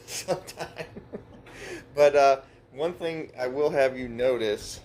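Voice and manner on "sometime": quiet, higher word through a chuckle; chuckled under the breath; laughing. Real, not acted. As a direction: trail into a soft laugh. Jokes doubtfully about coming back.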